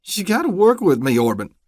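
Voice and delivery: high, shaky, scared begging; quavering plea. Acted. Vernon pleading with the deputy, voice quavering. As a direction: let the voice quaver with fear. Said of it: "Vernon's voice quavered".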